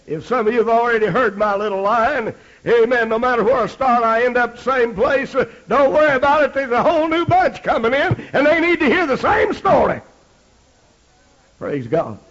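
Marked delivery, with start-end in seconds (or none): 0.1-10.0 high, loud raised preaching; rising sermon fervor. Acted. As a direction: press the point to the congregation.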